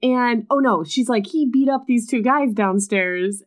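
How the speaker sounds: high, slow, over-the-top alarmed voice; hammed-up alarm, pitched high; mock panic. Abby bursting in to warn the aunt, hammed-up alarm.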